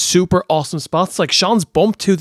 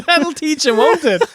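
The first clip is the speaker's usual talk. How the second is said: laughing; 12 semitones higher than usual. Real, not acted. Repeating joke, laughing.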